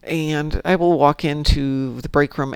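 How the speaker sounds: usual speaking voice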